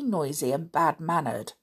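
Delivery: ordinary delivery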